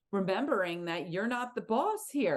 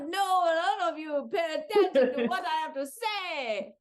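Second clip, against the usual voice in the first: higher-pitched mock indignation; Pepe Le Pew; 7 semitones higher than usual. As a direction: play an ignored, blustering officer. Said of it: "Pepe Le Pew's like".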